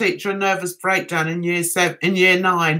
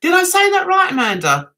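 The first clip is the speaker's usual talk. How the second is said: ordinary talk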